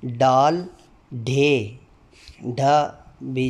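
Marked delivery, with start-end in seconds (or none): 0.1-1.8 each Urdu letter name sounded out slowly and distinctly. Shown as a demonstration. Teacher drilling the Urdu alphabet for demo candidates, slow and distinct. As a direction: recite the alphabet, one clear sound at a time. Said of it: "हर हरफ की आवाज़ अदा करते हुए".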